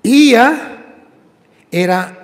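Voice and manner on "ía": Ía called out high and loud; shouted ending. Shown as a demonstration. Preacher crying the ending Ía to the congregation. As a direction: belt out Ía. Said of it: "todo termina Ía".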